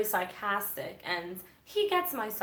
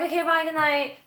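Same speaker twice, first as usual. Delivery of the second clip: higher, louder, fake-bright sign-off; mock-cheery goodbye; 8 semitones higher, 7 dB louder than usual. To the ex-friend in the parking lot, a fake-sweet goodbye. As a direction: brush her off with a sugary goodbye.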